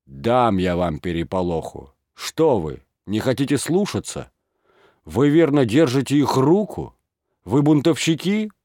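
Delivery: mayor furiously berating his men; angry scolding. Acted.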